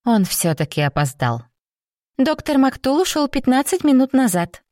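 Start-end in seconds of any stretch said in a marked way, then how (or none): 2.2-4.6 chirpy, much higher cute nurse voice; cartoon princess. A narrator's reading. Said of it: "прощебетала хорошенькая медсестричка".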